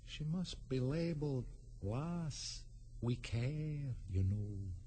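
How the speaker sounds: very soft, slow, faraway voice; faint, drawn-out gentle voice; very gentle, distant voice. A narrator's reading. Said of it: "a very gentle voice in the distance".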